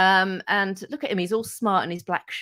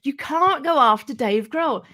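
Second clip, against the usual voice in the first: high, loud, slow mock warning; mock alarmed coworkers; colleagues warning her off; 8 semitones higher, 4 dB louder, slower than usual. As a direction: imitate the worried colleagues pleading. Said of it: "And they said".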